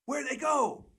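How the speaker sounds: very high, loud yelped question. Acted. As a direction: yelp it in a high hype-man impression. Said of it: "he looks at me, he goes".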